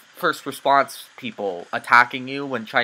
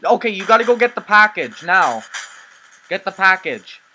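urgent yelling; 6 semitones higher, 9 dB louder than usual. Real, not acted. Urging teammate mid-heist.

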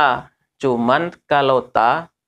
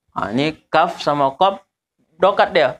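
normal talking voice

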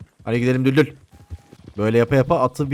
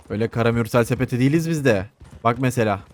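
ordinary talk, nothing marked

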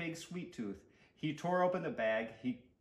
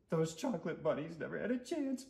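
higher, queasy little moan; moaned regret; 4 semitones higher, 3 dB quieter than usual. A narrator's reading. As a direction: groan it with a bellyache. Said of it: "moaned Stuart".

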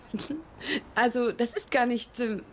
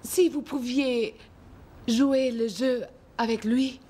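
put-on French accent. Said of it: "Regine Philange".

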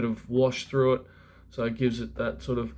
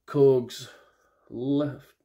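normal talking voice

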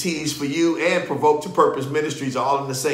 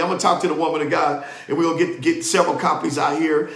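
ordinary talk, nothing marked

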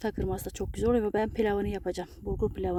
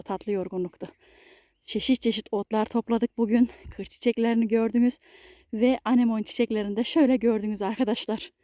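normal talking voice

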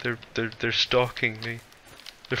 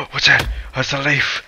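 gasp, then high, loud alarm; startled; 5 semitones higher, 5 dB louder than usual. Real, not acted. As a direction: jump at something harmless. Startled by a leaf in game.

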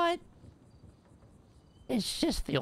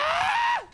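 a sudden high burst of laughter; shrieking laugh of surprise; surprised laugh; 5 dB louder than usual. Real, not acted. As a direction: laugh out in disbelief. Shocked by Benji's claim.

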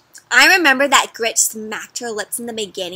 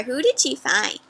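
ordinary delivery

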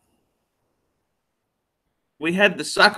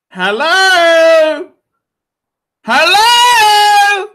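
calling out; 11 semitones higher, 7 dB louder, slower than usual. Acted. Begging viewers for super chats.

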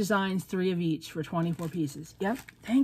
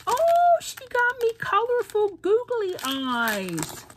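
much higher and louder burst on oh; thrilled squeal; 14 semitones higher, 5 dB louder, slower than usual. Real, not acted. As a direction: squeal at the googly eyes. Thrilled at googly eyes.

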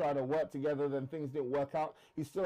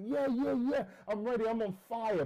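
eager excitement; 6 semitones higher than usual. Retold or imitated. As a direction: jump at the offer, all fired up. Eager young trader agreeing.

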